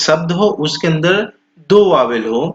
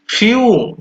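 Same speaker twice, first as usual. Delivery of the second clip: normal talking voice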